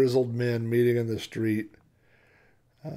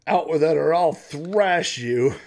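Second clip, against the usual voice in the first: slow, loud, higher-pitched menace; loud threat; 7 semitones higher, 10 dB louder, slower than usual. Acted. Sheriff threatens Willie for his secret, loud and slow. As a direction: suddenly demand it, drawing out each word.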